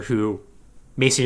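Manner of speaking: ordinary talk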